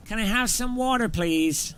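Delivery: a playful American diner order; mock American accent. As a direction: order water like an American tourist. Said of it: "American accent".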